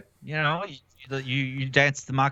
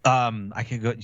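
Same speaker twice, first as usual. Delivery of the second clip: usual speaking voice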